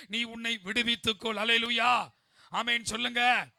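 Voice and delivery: insistent, increasingly loud sermon call; preaching building to full force. Acted. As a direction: drive each command harder at the congregation.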